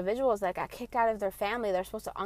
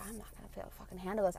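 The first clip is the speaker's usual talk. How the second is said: fast quiet dread; 10 dB quieter, much faster than usual. Retold or imitated. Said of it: "I was like".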